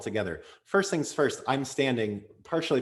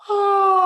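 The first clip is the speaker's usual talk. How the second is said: high, loud yawn sigh on ah; 12 dB louder than usual. Shown as a demonstration. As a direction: let a big yawn sigh out. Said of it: "a nice yawn sigh on ah".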